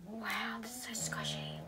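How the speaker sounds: whispered in awe; hushed whisper. Real, not acted. Vlogger whispering to viewers over a squishy toy. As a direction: breathe it out while squeezing.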